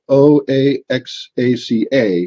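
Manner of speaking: letter by letter, slow and clear; spelled out slowly. Shown as a demonstration. Host spelling out the state's name, slowly. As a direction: spell each letter deliberately. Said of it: "spells out the name slowly".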